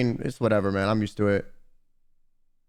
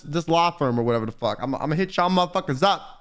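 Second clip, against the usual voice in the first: normal talking voice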